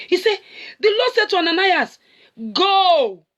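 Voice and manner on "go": commanding God. Acted.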